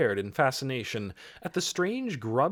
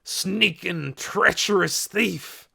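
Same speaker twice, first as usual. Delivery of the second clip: louder, higher, drawn-out accusation; angry shout; 8 semitones higher, 5 dB louder, slower than usual. A narrator's reading. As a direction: spit out the insult slowly and loudly. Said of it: "he shouted".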